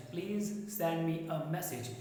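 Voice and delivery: very slow and clear, message as "meh-sij"; slow teacher demo; slow model sentence. Shown as a demonstration. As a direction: read it slowly for students. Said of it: "ये हो जाएगा तुम्हारा message".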